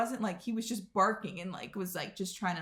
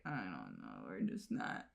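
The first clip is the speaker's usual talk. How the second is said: mumbled brush-off; 11 dB quieter than usual. Retold or imitated. Awkwardly turning him down.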